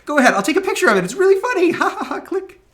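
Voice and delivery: put-on mother cheering her kids on, laughing high and loud; gleeful mom. Mother voiced urging kids' photo.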